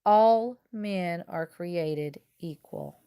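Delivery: very slow, deliberate delivery; slow reverent quotation; solemn quote. Acted. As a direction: give each word weight. Quoting the Gettysburg words.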